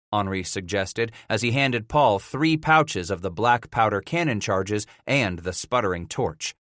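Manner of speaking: plain reading voice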